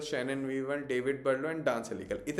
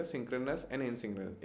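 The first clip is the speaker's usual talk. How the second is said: very slow, softer repeat of the terms; a teacher's soft, slow reminder of terms; 2 semitones lower, 8 dB quieter, much slower than usual. Shown as a demonstration. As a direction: say it again, quietly and slowly, for memory. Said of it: "तो please ये words याद रखिएगा".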